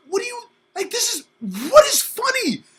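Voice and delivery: high, loud indignant cry; high loud outraged question; outraged kid. Retold or imitated. Kid outraged at laughing teachers.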